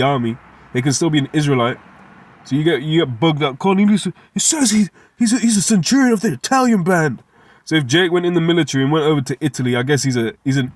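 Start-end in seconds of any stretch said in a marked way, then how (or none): 3.6-7.2 mocking. Mocking opponents' argument.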